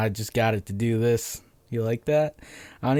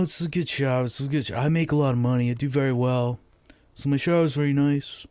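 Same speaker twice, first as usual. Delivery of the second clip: higher-pitched rough impression of the president; Trump impersonation; 8 semitones higher than usual. Comic acts out Trump giving a statement on his shower mishap, amateur Trump impersonation. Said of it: "doing a fucking Trump impersonation".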